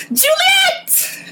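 very high fangirl shriek; excited squeal. Real, not acted. Fan spotting a favorite character on screen, squealing with excitement. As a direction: squeal the name in delight.

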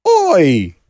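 mock Cockney shout. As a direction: yell it like a stage Cockney. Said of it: "you could do a Cockney accent".

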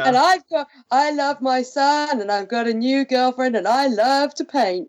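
high-pitched, hammed-up gushing bliss; Oscar. Co-host voices a doomed character's happy life, mock bliss. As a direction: gush dreamily in mock contentment. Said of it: "Oscar to be like".

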